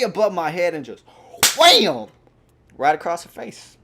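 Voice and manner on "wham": explosive high "wham"; sudden comic smack effect; loud slap noise. Retold or imitated. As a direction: shout the impact sound. Imitates the slap across face.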